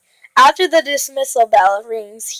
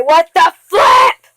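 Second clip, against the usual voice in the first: drawn-out, high mock shout; hammed-up outrage, slow and high; outraged exclamation; 6 semitones higher, 4 dB louder, slower than usual. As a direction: build to an exasperated yell. Reader's outraged reaction to story.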